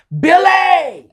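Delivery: sudden high shouted name; mom's yell. Retold or imitated. Mom yelling her son's name down the phone, loud shout. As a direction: yell his name like an angry mama. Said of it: "She yells at me".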